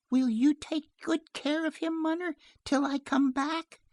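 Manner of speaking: put-on high-pitched small child's voice; pleading little girl. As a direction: ask mother sweetly in a little girl's high voice. Child Joan pleads with mother.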